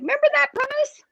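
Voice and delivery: a teasing heavenly nudge; lightly voiced Jesus asking a question. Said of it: "Jesus is like".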